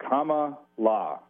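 "comma, la" in two separate parts; Kamala as "comma-la". Shown as a demonstration. Guest shows host how to say Kamala, "comma, la" spaced out. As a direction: say "comma, la" like the punctuation mark plus "la". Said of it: "You say it like the punctuation mark".

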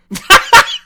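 squealing laugh; shrieking giggle; high burst of laughter. Real, not acted. Sudden laugh after odd remark.

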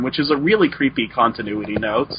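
normal talking voice

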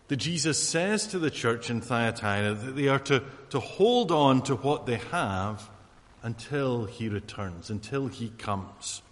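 ordinary delivery

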